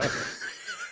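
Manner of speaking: breathy squealing laugh, very high; high squeaky laugh. Real, not acted. Co-host squealing with laughter at herself.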